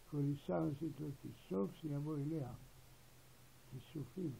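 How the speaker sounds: ordinary talk, nothing marked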